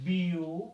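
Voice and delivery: বিউটিফুল stretched as বিউ ... টি ... ফুল; long pauses inside বিউটিফুল, each syllable held out; slow teacher's breakdown of বিউটিফুল into three parts; split into three syllables. Shown as a demonstration. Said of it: "তিনবারে ভেঙে উচ্চারণ করেছি".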